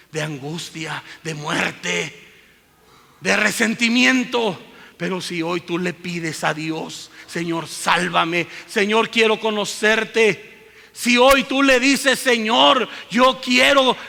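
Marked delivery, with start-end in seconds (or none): 3.2-14.0 much higher and louder impassioned plea; loud fervent sermon climax; preaching climax. Real, not acted. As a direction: build to a fervent crescendo.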